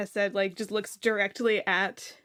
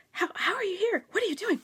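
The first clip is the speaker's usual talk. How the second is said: high-pitched, flustered delight; excited greeting; 10 semitones higher, faster than usual. Acted. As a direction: fire eager questions at an old friend. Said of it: "is just like".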